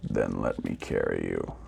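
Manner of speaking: deep, quiet, forlorn robot; sad robot. A narrator's reading. Robot speaks in the story.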